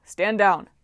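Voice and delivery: loud commanding voice; firm order. A narrator's reading. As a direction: command them to stop. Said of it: "someone ordered".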